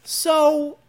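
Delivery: helium voice. Pretend. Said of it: "Tell me you're gonna talk with a high voice like a helium balloon".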